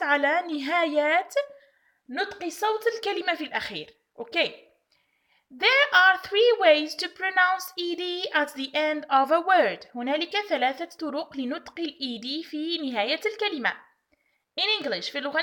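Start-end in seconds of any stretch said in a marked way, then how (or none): 5.5-9.9 slow, careful teacher reading; deliberate classroom pace; slow rule reading. Shown as a demonstration. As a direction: read each word slowly for the class. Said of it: "teacher reading rule slowly".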